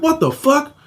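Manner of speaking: high-pitched, alarmed questioning; retold worry. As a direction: imitate a worried older brother. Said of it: "And he was worried".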